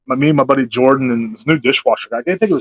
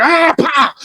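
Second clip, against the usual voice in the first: high yelp, then a slap noise; imitated slap; 13 semitones higher than usual. Storyteller acting out slapping the man, high imitated yelp. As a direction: mimic a panicked swing with a high cry.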